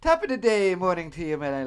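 hammed-up fake British greeting; mock British, jumbled and cheery; bad British accent. Shown as a demonstration. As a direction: do a clumsy American take on a British accent. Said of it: "when we do British accents, we go".

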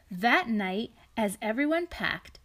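storybook read-aloud, lightly lilting; reading to children. A narrator's reading. Parent reading a picture book to a child, gentle storytelling. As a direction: read it to a little one beside you.

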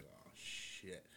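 hushed oh-shit whisper. Retold or imitated. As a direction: breathe it out in fear. Teen fearing dad's reaction.

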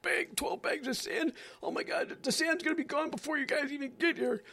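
very high, shrill mock alarm; hammed-up reporter freaking out; panicky reporter. Reporter panicking over sandbags.

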